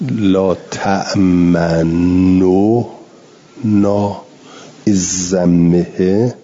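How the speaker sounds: لا تأمنو with the nun vowel rounded toward u, slow and drawn; ishmam on tamanna. Shown as a demonstration. Teacher demonstrating the ishmam in لا تأمنا slowly. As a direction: draw out تأمنو with rounded lips before نا. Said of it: "بین این دوتا بشه".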